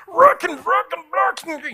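high, loud mock yell of ruckin; mock yell of a wrestler raging after his loss; imitated yelling. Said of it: "you can hear him yelling".

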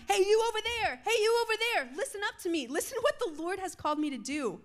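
high-pitched, eager call-out; acted yelling. Preacher voices Isaiah calling out to distant lands, yelling. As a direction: holler it to people far off. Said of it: "He was literally yelling".

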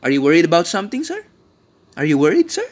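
mock office comeback. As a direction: turn the question back on the boss. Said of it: "yun ay reply nila".

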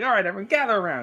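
high, quick mock summons; acted gather-round call; calling everyone over. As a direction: round up the group briskly. Acted character calling group together.

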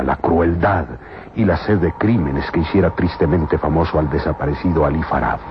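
ordinary delivery

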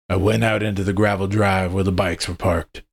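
ordinary delivery

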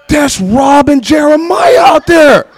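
slow, loud dismayed exclaim; shocked recognition. Retold or imitated. New believer spotting his church friends, slow shocked cry. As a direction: spell out each word in horror.

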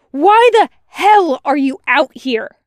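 high, very loud demand; angry yell. A narrator's reading. Wash confronts Tucker on the road, yelling. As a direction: yell the question at him. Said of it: "he yells".

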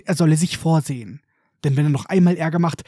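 usual speaking voice

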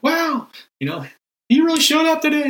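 high, gushing mock praise. Preacher mocking showy worship, high and gushing. Mocking over-the-top prayer reaction.

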